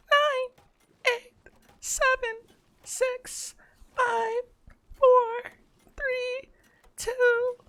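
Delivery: put-on very high cutesy e-girl voice; sweet high-pitched streamer girl. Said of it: "E-grill voice".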